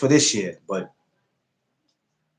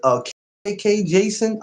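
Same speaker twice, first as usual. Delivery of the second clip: usual speaking voice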